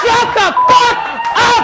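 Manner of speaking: laughing shout. Real, not acted. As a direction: yell it again half laughing. Repeated at heckler.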